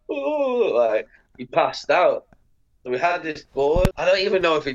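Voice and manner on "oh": high, drawn-out imitated woozy "ooh". Imitating Ben about to pass out, a high woozy moan. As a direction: float a long high "ooh". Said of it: "Ben got a bit like".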